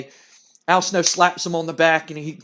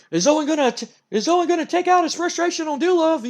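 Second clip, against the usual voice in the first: high-pitched excited commentary; wrestling announcer; 11 semitones higher than usual. Acted. Announcer watching a tense handshake after the match, excited. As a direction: call it with raised, suspenseful urgency. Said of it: "Like, the announcer's like".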